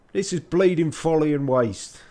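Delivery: irritable artificer in broad Yorkshire; grumbling Yorkshire artificer. Acted. Artificer complains about wasted effort, grumbling Yorkshire accent. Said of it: "grumbled in a thick ... Yorkshire accent".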